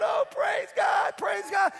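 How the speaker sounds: high, loud drawn-out cries of praise; retold screaming praise. Healed grandmother coming out crying, screaming praise. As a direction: imitate a woman screaming her thanks to God. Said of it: "She was screaming".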